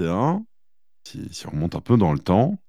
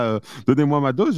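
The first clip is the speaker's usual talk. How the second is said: mock junkie craving; 7 semitones higher than usual. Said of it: "comme un addict à sa cam".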